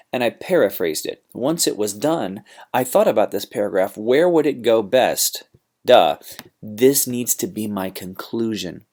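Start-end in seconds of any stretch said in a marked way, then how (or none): 5.8-6.2 loud, teasing duh, as if it were obvious; mock duh. Real, not acted. Teacher teasing students about the obvious point, a loud mock duh. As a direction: toss it off like it's too obvious to say.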